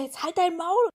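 high mock shout; mock yelling across room. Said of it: "schreien die sich übers Wohnzimmer an". (nachgeahmtes Quer-durchs-Zimmer-Schreien, hoch)

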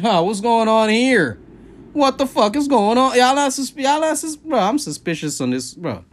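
suspicious. Acted. Suspicious mock reaction.

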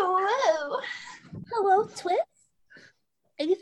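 high, put-on anime girl greeting; cutesy anime girl hello. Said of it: "her lines in that voice".

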